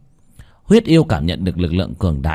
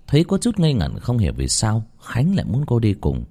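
ordinary delivery

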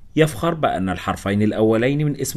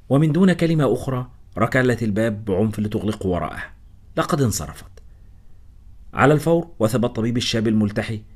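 ordinary delivery, nothing marked

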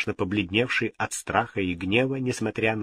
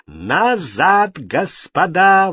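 higher, louder, slow command; commanding cry; 8 semitones higher, 7 dB louder, much slower than usual. A narrator's reading. Catherine orders the courtiers to stand back. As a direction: stop the drawn swords with your voice. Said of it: "воскликнула Екатерина".

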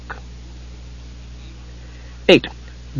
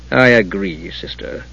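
yielding, the last word sighed out; bitter sigh; 5 semitones lower, 6 dB quieter, slower than usual. A narrator's reading. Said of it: "he said with a bitter sigh".

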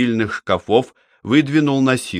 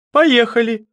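high-pitched, eager agreement; keenly interested; 12 semitones higher, 4 dB louder than usual. A narrator's reading. Chemist accepts the trip to the morgue, keenly interested. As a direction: agree at once, voice leaping up. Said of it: "Цветов был очень заинтересован".

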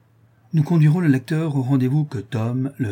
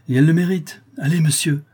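high, raised, fervent voice; exalted; 6 semitones higher, 5 dB louder than usual. A narrator's reading. La Louve vouches for the girl to the count, exalted. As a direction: insist with lifted, glowing fervour. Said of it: "reprit la Louve avec exaltation".

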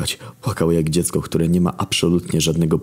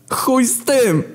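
yelling through tears, much higher; tearful shout; much slower than usual. Acted. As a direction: cry it out desperately. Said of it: "wykrzyczałem przez łzy".

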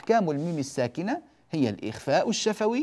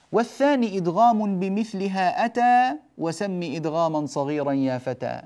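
raised opening line of the poem; verse recital; 4 semitones higher, 3 dB louder than usual. Acted. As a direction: chant the rhyme for students.

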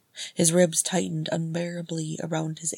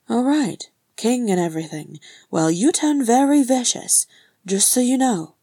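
higher, loud cheeky comeback; unintimidated retort; 6 semitones higher, 7 dB louder than usual. Acted. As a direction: answer back, not cowed.

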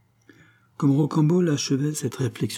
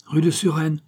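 higher-pitched mild call to the driver; 6 semitones higher than usual. A narrator's reading. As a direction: call the address out, without a full shout. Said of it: "lui cria Rocambole". (lancé d'une voix plus haute, comme un appel)

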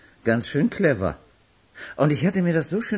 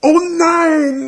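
much higher, louder groan of dismay; dismayed cry; 6 dB louder than usual. Acted. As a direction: cry out at bad luck. Dismay at drawing the lot.

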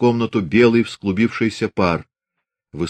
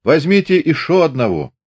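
cheerful shout; 8 semitones higher, 4 dB louder than usual. A narrator's reading. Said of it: "весело крикнул".

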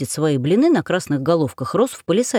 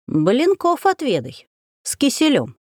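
сказочная старуха; 6 semitones higher than usual. Acted. Яга потчует гостью блинами.

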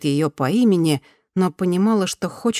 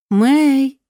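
higher, louder call of a name; 9 semitones higher, 6 dB louder than usual. A narrator's reading. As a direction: call her name to get attention. Голос зовёт Мэй по имени. (громкий зов по имени)

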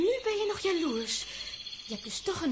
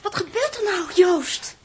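louder, higher, alarmed; anxious cry; 5 semitones higher, 10 dB louder, slower than usual. Acted. Els asking Joost what is happening, anxious loud cry. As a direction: call out in alarm.

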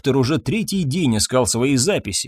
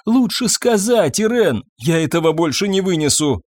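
high, distraught plea; wailing; 6 semitones higher than usual. A narrator's reading. Husband begs wife, unable to bear it, wailing. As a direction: wail that it is unbearable. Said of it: "взвыл Фабьен".

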